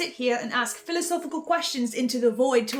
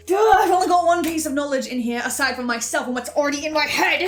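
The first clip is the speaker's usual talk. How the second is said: frantic realization; 3 semitones higher, 8 dB louder than usual. Acted.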